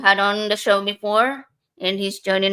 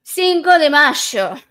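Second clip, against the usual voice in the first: Argentinian Spanish, "Mayo" said "masho", drawn out and lifted; Argentinian accent; 7 semitones higher, 8 dB louder, slower than usual. Shown as a demonstration. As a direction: Argentinian accent, sung out slowly. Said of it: "I'm gonna say it in an Argentinian accent".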